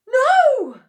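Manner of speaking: very high, very loud cry; cried out. A narrator's reading. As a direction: stop her in panic. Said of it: "cried Missus Cranky".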